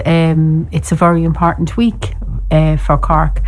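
ordinary delivery, nothing marked

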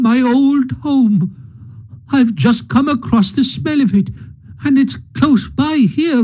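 high, slow, softening plea; pleading with Ratty to turn back home; anguished pleading. Acted. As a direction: beg desperately, fading as he fails. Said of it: "pleaded the poor mole in anguish".

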